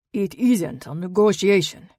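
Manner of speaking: put-on loud, heavy Eastern European accent retort; a menacing buyer in a thick Eastern European accent. A narrator's reading. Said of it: "an Eastern European accent thickening his words".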